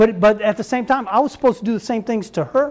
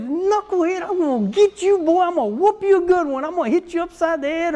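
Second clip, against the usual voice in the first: high mock-tough threats; playful dad threat; 6 semitones higher than usual. Dad teasing his kids with whoopings, high mock threat. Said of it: "I used to always tell the kids".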